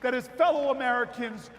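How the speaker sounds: rising to a shouted, slow rally cry; preaching at full force. Acted. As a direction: build to a full-throated call to the crowd. Rally speech climax.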